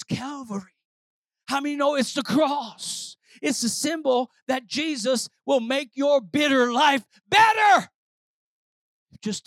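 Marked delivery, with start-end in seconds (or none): 1.5-7.9 rising from firm to a high, loud shout; building preaching. Real, not acted. Preacher proclaiming the cross, building to a full-force shout. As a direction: build steadily and burst on the last word.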